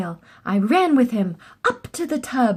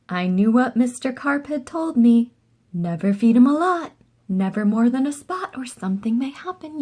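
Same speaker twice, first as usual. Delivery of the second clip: ordinary delivery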